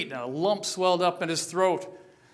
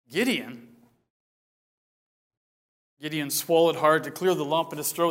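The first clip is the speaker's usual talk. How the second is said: usual speaking voice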